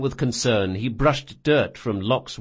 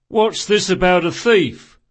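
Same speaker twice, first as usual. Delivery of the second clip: loud, raised demand; watch sergeant; 7 semitones higher, 7 dB louder than usual. Acted. Sergeant running up to the scene, loud demanding question. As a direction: demand it like an arriving officer.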